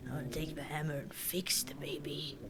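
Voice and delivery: soft whisper, breath only; whispered aside. Real, not acted. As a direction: whisper it like a secret plan.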